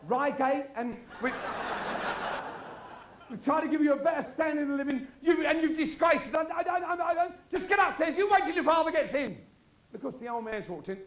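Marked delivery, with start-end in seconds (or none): 0.1-9.4 posh mother scolding. Acted. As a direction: scold your son in a high affronted voice.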